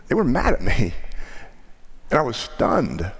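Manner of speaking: usual speaking voice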